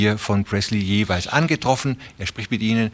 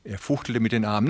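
usual speaking voice

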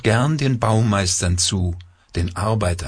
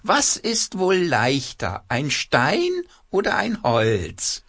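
high, slow quacking puppet; 10 semitones higher than usual. A narrator's reading. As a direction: squawk it out like a cloth puppet. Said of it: "mit verstellter Stimme quakt er los".

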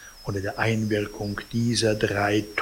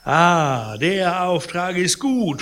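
bitter mock laugh; 7 semitones higher than usual. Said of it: "unter tollem Gelächter".

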